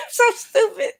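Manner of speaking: high, squeaky laughing words. Real, not acted. Reader amused by her own voice, laughing. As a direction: squeeze it out through a fit of giggles.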